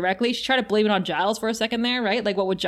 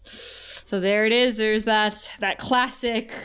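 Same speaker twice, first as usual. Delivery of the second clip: ordinary talk, nothing marked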